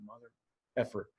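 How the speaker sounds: teary at the top of the climb, muttering a curse; muttered curse. Retold or imitated. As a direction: mutter it to yourself, tearing up. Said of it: "and I'm going".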